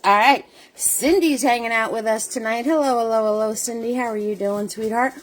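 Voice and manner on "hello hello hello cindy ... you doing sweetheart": ordinary talk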